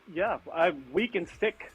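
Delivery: usual speaking voice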